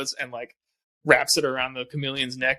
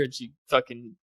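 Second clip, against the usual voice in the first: usual speaking voice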